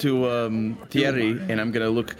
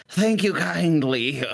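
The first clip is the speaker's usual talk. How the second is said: put-on slow, high, shaky old-man thank-you; very old faint voice; 5 semitones higher, much slower than usual. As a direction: creak it out like a frail elder. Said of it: "affected voice of, uh, trying to imitate an old person".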